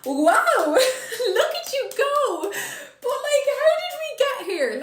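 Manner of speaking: high, loud, slow laughing wonder; amazed, laughing. Retold or imitated. Marvelling at her sister's family, laughing high and loud. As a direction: gush with delighted disbelief. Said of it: "you're just like".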